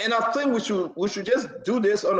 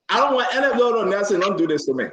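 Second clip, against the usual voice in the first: ordinary talk, nothing marked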